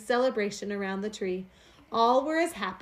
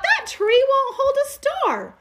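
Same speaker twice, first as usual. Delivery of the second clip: put-on much higher, louder, slow child's call; little bear crying out; 7 dB louder, slower than usual. A narrator's reading. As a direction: call out from afar like a small cub. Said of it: "cried out".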